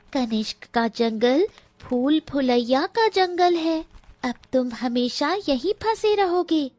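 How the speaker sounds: high, bird-like warning voice; a magic bird menacing the lost pair; high myna voice foretelling doom; talking myna bird. A narrator's reading. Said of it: "वो मनुष्य की आवाज में बोली".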